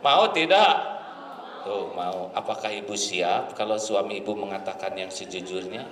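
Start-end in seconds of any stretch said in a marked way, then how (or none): 0.1-0.7 higher, louder repeat on tidak; repeated question, raised and insistent; pressing louder ask. Real, not acted. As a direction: push the crowd for an answer. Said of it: "apakah Ibu mau punya suami jujur".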